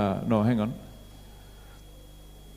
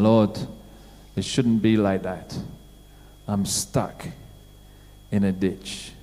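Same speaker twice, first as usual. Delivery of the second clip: lower, much softer moaning plea; groaning prayer; 9 semitones lower, 7 dB quieter than usual. Retold or imitated. Believer stuck in a ditch moaning to the Lord, soft and low. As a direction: moan it quietly to God. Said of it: "you're groaning and moaning and saying".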